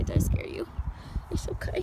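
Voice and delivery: whispered reassurance; whispering to calm a startled pony; soothing whisper. Real, not acted. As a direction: hush him gently.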